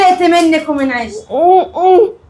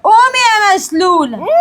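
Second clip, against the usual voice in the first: taunting shout; 6 semitones higher than usual. Acted. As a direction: scream the insult at her. Taunting paralysed mother-in-law.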